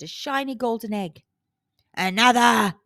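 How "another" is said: giant growl. A narrator's reading. Giant demands more golden eggs, growling. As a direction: demand more like a greedy brute. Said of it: "growled the giant".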